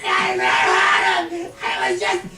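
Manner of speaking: agonized screams of panic; screaming. Acted. Man caught in the fire pleading, screaming. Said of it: "Stop the screaming!".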